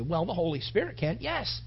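usual speaking voice

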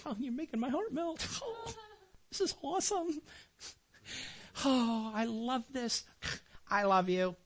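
high, soft, gushing mock delight; hammed-up melting sigh of love; mock swooning. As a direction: gush over the toy like a fool. Mock swooning over the doll.

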